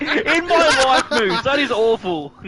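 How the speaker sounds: laughing loud and high through the words. Real, not acted. Co-commentator mocking partner's Nadal impression, laughing loudly. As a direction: crack up as you mock it.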